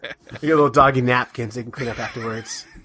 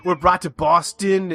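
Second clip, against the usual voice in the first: hammed-up Boston accent, higher pitched; 5 semitones higher, slower than usual. Shown as a demonstration. Podcaster demonstrating how to win over Boston listeners, hammed-up Boston accent. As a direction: put on your best Boston accent, drawn out. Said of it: "in our best Boston accent".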